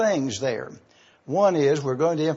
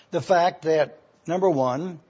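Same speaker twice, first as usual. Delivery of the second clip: ordinary delivery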